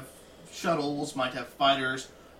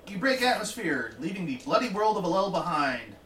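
game master narration; 4 semitones higher than usual. Acted.

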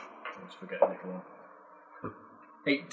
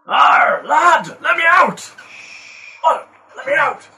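loud, high pleading in a hammed-up Scottish brogue; a caged dwarf begging in fake Scottish; fake Scottish accent; 11 semitones higher, 10 dB louder than usual. Trapped dwarf begs the party to free him, hammed-up Scottish accent. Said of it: "You and your fake Scottish accent".

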